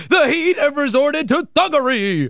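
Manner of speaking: mimicking the announcer. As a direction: echo the call with mock outrage. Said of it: "Give me that one again".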